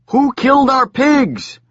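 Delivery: high, slow furious yell; angry yell. Acted. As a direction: roar it at the strangers. Angry crowd yelling.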